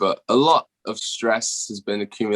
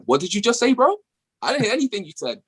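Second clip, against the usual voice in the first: mock confused listener, pitched much higher; baffled listener; 12 semitones higher, faster than usual. Guest acting out his own confusion at Southern speech, in a high mock-baffled voice. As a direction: play someone who could not catch a word, high and incredulous. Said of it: "You're like".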